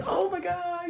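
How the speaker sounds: very high, soft mock squeal; airy put-on girly-sweet exclamation, kept small; mock perky voice. Shown as a demonstration. Mocking what coworkers expected of her talk, high soft squeal. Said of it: "I wasn't talking like this".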